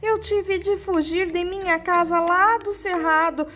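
high, tearful begging wolf; pleading whimper. Acted. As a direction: beg for your life through tears. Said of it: "começou a lamuriar e a chorar".

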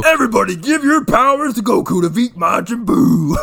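loud, high, hammed-up rallying cry; over-the-top anime shout; Mister Satan. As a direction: bellow a plea to the whole world. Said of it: "He's Mister Satan at the end".

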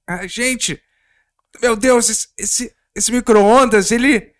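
high, loud acted panic; panicked screaming. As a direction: shriek as the microwave attacks. Microwave skit, head inside it.

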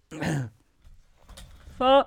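high shouted warm-up; shouting. Acted. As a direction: try the shout again. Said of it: "ちゃんと叫びたいよね".

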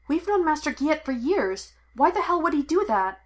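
can't believe what she hears; sharp, rising disbelief; upset disbelief. Acted.

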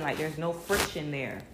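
ordinary delivery

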